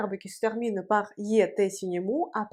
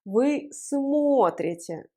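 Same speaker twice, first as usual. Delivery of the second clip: смотрите drawn out on the stressed о; 3 semitones higher, much slower than usual. Shown as a demonstration. Teacher repeating for learners, смотрите stressed on о. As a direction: slowly stretch the first vowel of смотрите. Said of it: "L'accent reste sur le O".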